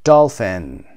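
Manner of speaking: Dolphin with a loud DOL and a falling end; first-syllable stress. Shown as a demonstration. Teacher marking the stressed syllable for learners, loud DOL in Dolphin. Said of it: "قسمت اول".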